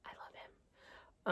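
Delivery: "I love him." fully whispered and slowed right down; whispered confession. Shown as a demonstration. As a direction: breathe "I love him." like a guilty secret. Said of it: "Let me just say".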